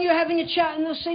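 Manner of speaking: ordinary delivery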